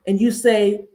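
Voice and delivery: usual speaking voice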